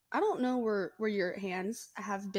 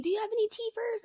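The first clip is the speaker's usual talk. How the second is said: put-on high, quick baby-talk coo; imitating a cooing old lady; 12 semitones higher, faster than usual. Old lady at Walmart baby-talking the baby, high and quick. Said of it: "starts, like, saying".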